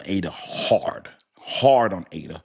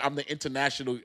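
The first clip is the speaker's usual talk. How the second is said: ordinary talk, nothing marked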